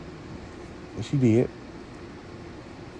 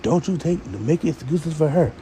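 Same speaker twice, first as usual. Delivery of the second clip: imitating Robin; 7 semitones higher than usual.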